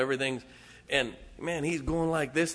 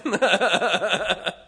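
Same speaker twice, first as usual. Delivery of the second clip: big burst of loud laughter; loud real laugh; 4 semitones higher, 8 dB louder than usual. Real, not acted. Preacher laughing at his own joke.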